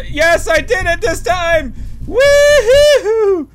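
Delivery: high, loud victory cheer; triumphant whoop. Real, not acted. Gamer cheering after jumping the fence. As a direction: celebrate like you just won.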